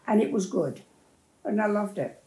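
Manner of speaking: usual speaking voice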